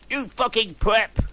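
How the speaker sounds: loud, high mock yell at the traitor; angry yell. Friends yelling at Draco, loud and high angry. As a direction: snarl the insult at him. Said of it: "we all yielded angrily".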